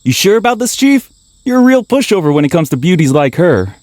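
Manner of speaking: loud, high-pitched ribbing of the boss; teasing. Acted. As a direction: tease the chief at full volume. Crew member teases the chief.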